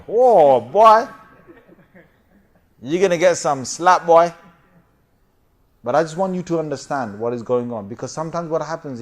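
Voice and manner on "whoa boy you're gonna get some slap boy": loud warning. Acted. Warning kids about dad.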